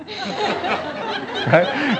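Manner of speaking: high, giggling right; laughing. Real, not acted. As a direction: laugh through the word. Laugh while telling prank.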